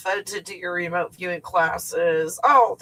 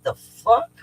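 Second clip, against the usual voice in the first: higher, louder incredulous burst; incredulous reaction; 6 semitones higher, 5 dB louder than usual. Retold or imitated. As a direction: blurt it in mock disbelief. Acted reaction to others' praise.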